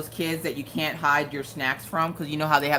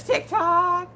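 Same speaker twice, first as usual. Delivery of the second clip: mock Mister Coffee; 3 dB louder than usual. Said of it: "Man, he says".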